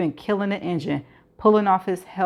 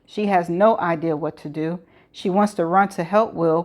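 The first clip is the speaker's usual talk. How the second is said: usual speaking voice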